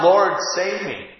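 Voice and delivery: slow, loud, high plea for rescue; a sinking man crying out to his Lord; cry for help. Acted. Peter sinking, crying out to Jesus, slow and loud. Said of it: "cried out".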